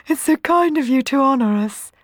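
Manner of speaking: high, loud, fawning sweetness; sweet flattery. A narrator's reading. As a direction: flatter royalty with a sweet smile. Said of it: "flattered Tisby, smiling sweetly".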